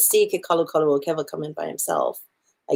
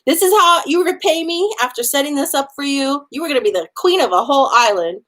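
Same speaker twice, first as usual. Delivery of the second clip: rage; 7 semitones higher, faster than usual. Retold or imitated. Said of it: "goes into a rage".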